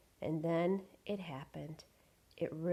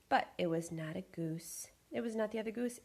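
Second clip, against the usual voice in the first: plain reading voice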